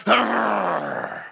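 imitated snarl of an angry four-year-old; toddler growl. Father imitating his angry four-year-old son, mock growl. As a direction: growl it like a cross little boy. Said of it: "snarls up his lip and he goes".

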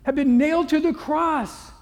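high, loud, slow whiny groan; mock complaint. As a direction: ham up a petty gripe. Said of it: "He didn't say".

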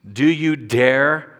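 slow it down and lean on "dare"; slow emphatic. Shown as a demonstration. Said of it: "Dare is the first word".

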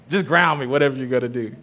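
ordinary talk

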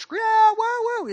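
high whooping cheer; mock kids yelling woo. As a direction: whoop like a thrilled classroom. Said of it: "Kids are yelling".

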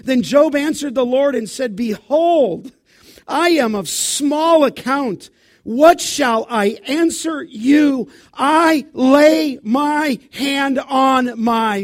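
loud, slow pulpit reading; preaching at full force. Acted. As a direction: drive each word to the back row. Preaching scripture loudly.

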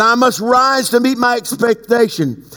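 high, stretched-out proclamation; fervent preaching. Real, not acted. Preacher declaring the word God gave, fervent preaching. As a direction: proclaim it high, drawing the phrases out.

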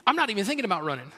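ordinary delivery

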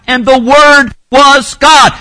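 loud, slow "the Word was God", each word hammered; emphatic scripture. Shown as a demonstration. Preacher proves his point from scripture, loud and emphatic.

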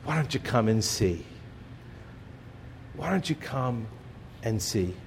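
soft, gentle invitation with low pitch; kindly. Acted. Jesus gently inviting the hesitant disciples, soft and kind. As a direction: invite them in tenderly, almost hushed. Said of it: "Jesus responds very kindly".

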